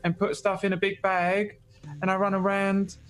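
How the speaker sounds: a hint of the Archie impression. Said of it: "doing impressions of it".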